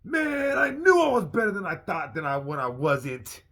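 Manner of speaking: very high, loud indignant cry; outraged shout. Retold or imitated. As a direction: yell at yourself in disbelief. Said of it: "Now I felt like".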